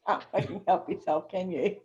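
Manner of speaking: teasing through a laugh; can't keep the laugh in. Real, not acted. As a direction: laugh it out, low and amused. Amused teasing of co-host's crude remark.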